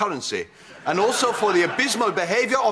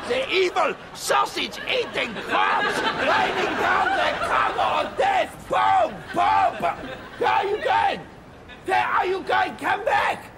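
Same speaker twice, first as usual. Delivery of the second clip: put-on much higher, louder German-accented ranting; hammed-up German war frenzy; frenzied shouting; 8 semitones higher, 3 dB louder than usual. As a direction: rave like a bomber raid commander. Acting out Nazi bombing raid.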